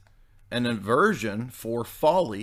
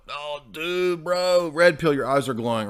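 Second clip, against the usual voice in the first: red-pilled bro; 4 semitones higher, 4 dB louder than usual. Acted. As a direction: gush it like an awestruck bro.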